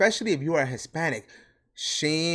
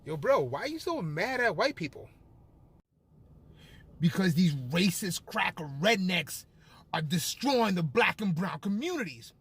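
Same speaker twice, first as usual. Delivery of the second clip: acted dialogue; 4 semitones higher, 3 dB quieter than usual. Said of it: "You ever had this conversation?".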